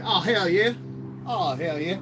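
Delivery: loud, high mock Southern hoot; imitating J W. Quoting friend J W, loud mock Southern hoot. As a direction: whoop it like a good old Alabama boy. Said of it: "And he says".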